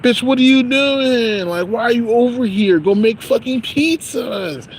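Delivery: high, slow mock scolding. Coworker telling off a girl loitering, high slow scolding. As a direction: sneer it out like telling her off. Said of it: "I'm like".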